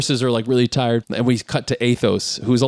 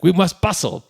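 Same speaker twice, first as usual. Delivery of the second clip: pompous duke; 6 semitones higher than usual. Retold or imitated. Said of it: "He's like".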